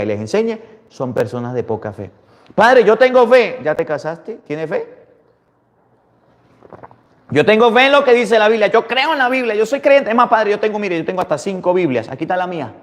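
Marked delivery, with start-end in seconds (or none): 2.5-3.6 higher, louder protest; mock churchgoer insisting; imitating a parishioner. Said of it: "parishioner voiced". 7.3-12.7 mock churchgoer insisting; imitating a parishioner. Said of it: "parishioner voiced".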